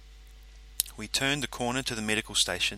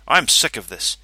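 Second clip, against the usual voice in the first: louder, rising angry outburst; growing angry; 4 semitones higher, 6 dB louder than usual. Acted. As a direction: let the anger build. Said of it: "My voice grew louder as I grew angrier".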